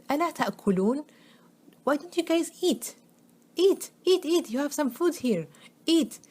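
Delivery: high, loud mocking demand to eat; upset taunting. Ibrahim taunting the idols to eat, high and upset. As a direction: goad the idols scornfully. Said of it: "And he's so upset".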